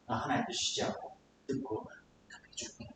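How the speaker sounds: very soft, low promise; God's gentle reassurance. Acted. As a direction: reassure tenderly, almost under the breath. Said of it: "하나님의 마음으로 보세요".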